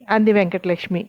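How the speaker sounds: usual speaking voice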